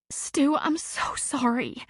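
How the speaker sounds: a tearful apology to her boss; on the verge of tears. A narrator's reading. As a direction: near tears as she apologizes. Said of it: "she burst into tears".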